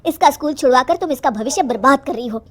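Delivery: angry father. Acted. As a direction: take your mother to task, loudly. Father angrily confronts his mother. (गुस्से में पिता की ऊँची आवाज़)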